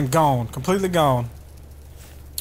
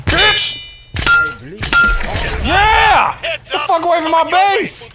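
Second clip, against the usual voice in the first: high, loud victory whoop; triumphant yell; 9 semitones higher, 5 dB louder, slower than usual. Real, not acted. Gamer celebrating a shotgun kill, triumphant yell. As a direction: shout it out after the kill.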